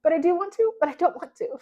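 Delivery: high, whiny back-and-forth protest. Retold or imitated. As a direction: whine like a reluctant reader torn both ways. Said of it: "I'm like".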